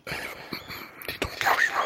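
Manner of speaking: hushed airy whisper; ASMR whisper. Acted. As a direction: whisper close to the mic.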